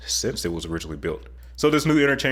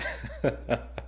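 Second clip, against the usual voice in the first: short unvoiced laugh; a quick soft laugh under the breath; breathy chuckle; 3 semitones higher, 7 dB quieter than usual. Real, not acted. Teasing the governor's accent, quiet breathy chuckle.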